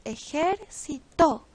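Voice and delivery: ejército split up, final TO stressed; stress on last syllable. Shown as a demonstration. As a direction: say ejército as e-jer-ci-TÓ. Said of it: "La aguda lleva su sílaba tónica".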